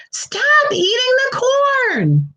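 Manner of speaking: high, slow, stretched frog shout. A narrator's reading. Frog Jerome yelling at the crow eating corn, high slow shout. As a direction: cry it out to shoo the crow.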